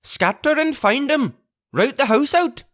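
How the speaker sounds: high, furious order; angry order. Acted. Pugh repeats his order to the crew, furious. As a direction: hammer the order home. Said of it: "reiterated Pugh".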